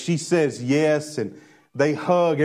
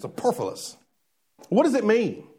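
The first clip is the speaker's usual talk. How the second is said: Sesame Street; 6 semitones higher than usual. Pretend. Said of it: "Sounds like an episode of Sesame Street".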